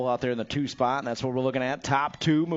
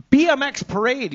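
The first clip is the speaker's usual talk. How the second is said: excited; 10 semitones higher, 7 dB louder than usual. Real, not acted. Hyping tomorrow's parade.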